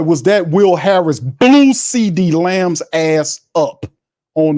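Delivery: high, slowed, stunned excitement at a huge hit; excited reaction. Real, not acted. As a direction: marvel at the hit, drawing the words out. Big hit on the play.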